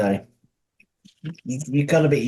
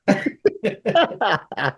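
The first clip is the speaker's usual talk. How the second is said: high laugh; 10 semitones higher than usual. Real, not acted. Laughs after own impression.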